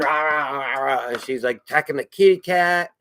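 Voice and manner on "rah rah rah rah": repeated "rah" as a cat's attack cry; mock angry cat roar. Angry cat attacking the new kitten, mock roar. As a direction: imitate a cat going on the attack.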